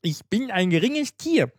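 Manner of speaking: very high, hammed-up bee impression; little bee. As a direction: speak as a tiny, humble insect. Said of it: "Die Biene sagte".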